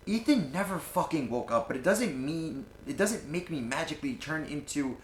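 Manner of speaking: high, heated grief-stricken outburst; acted monologue, upset and indignant; anguished anger. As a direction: protest bitterly, pitch riding high. Acted monologue, anger at friend's death.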